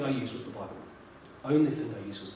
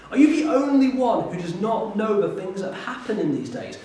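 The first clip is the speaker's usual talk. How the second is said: higher, louder, half-laughing disbelief; can't believe the stranger hasn't heard; incredulous disbelief; 8 semitones higher, 4 dB louder than usual. Acted.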